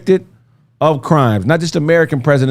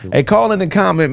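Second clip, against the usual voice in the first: ordinary talk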